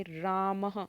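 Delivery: रामः said slowly with a light breathy h at the end; slow demo of रामः ending in a little h; teacher's model word. Shown as a demonstration. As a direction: end रामः on a soft echoing h sound. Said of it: "ह की sound आती है".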